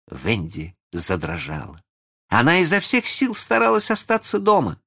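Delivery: plain reading voice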